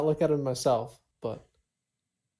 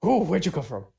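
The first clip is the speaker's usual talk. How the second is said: startled, quick blurted question; spooked; 5 semitones higher, 6 dB louder than usual. Acted. Player startled by the creature behind them, acting spooked. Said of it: "I would be very spooked".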